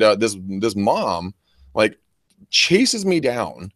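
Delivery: ordinary talk, nothing marked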